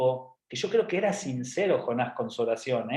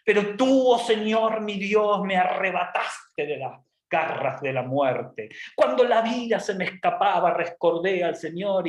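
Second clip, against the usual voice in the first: Jonah's pompous prayer; 4 semitones higher than usual. Acted. Jonah addressing his Lord, hammed-up pomp. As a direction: proclaim it slowly with mock grandeur. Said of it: "toda la pompa".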